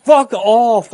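high loud startled yell; panicked scream. Real, not acted. Another jump scare.

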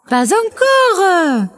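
« pas encore » étiré, très aigu; mère poule refusant. A narrator's reading. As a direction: chantonne le refus, haut perché. La poule arrête le loup. (poule de conte, voix aiguë et traînante)

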